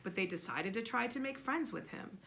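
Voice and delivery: plain reading voice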